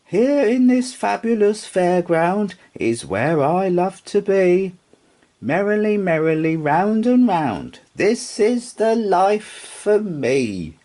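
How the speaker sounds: high, happy little rocking horse voice; a contented toy horse, sung out high; Sugarlump's high voice. Acted. Rocking horse content in the bedroom, high and happy.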